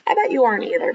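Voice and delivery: normal talking voice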